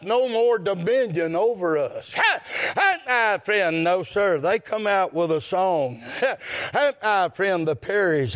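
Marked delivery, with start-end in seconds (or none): none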